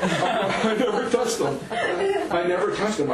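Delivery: high, slow words through laughter; laughing denial. Real, not acted. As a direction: protest while cracking up. Amused at friend's mistaken question.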